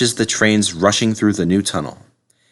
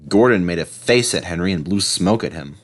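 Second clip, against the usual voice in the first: usual speaking voice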